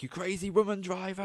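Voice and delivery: an imitation of a driver's outraged shout; angry truck driver. Truck driver yelling, quoted.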